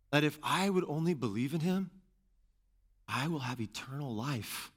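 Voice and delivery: "I" leaned on, softening toward "eternal life"; personalized reading. Shown as a demonstration. Preacher making the verse personal to himself, reflective. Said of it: "reread the passage using personal pronouns".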